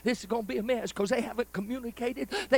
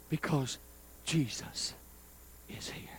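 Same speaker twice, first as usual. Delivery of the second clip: hushed reverence; 7 semitones lower, 9 dB quieter than usual. Retold or imitated. Retells the answer about Jesus.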